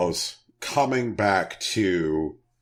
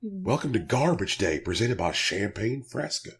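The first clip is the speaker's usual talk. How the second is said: hungover. Acted. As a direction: speak like the morning after a binge. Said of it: "Sorry, I sound a little hungover".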